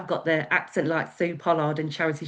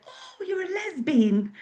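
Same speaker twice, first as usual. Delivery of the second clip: high, slow, wide-eyed mum; surprised mum; 11 semitones higher, slower than usual. Retold or imitated. Mum hears her daughter come out, high and slow in surprise. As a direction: draw out the word, astonished. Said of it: "And she said".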